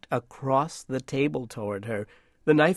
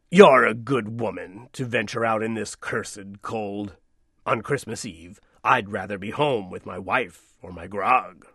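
gruff coachman; 4 semitones lower than usual. Acted. Coachman speaking.

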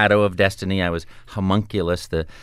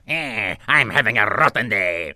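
grumpy villain grumble; Wario voice. Shown as a demonstration. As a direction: sour cartoon bad guy. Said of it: "Or Wario can go".

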